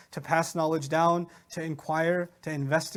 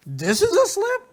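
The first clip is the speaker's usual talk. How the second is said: loud, high-pitched disbelieving question; mock-astonished squeal of a question; incredulous; 12 semitones higher, 6 dB louder than usual. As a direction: act out total disbelief, voice shooting up. Professor's incredulity acted out.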